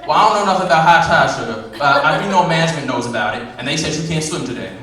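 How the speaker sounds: loud, quick sassy brush-off; sassy clerk. Acted. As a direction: lay down the law like a bossy clerk. Clerk loudly asserting rules.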